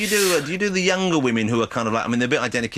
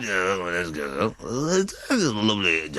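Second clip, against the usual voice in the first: very slow, drawn-out impression; uncanny impression; 3 semitones lower, much slower than usual. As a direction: draw it out, uncanny impersonation. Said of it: "that's uncanny".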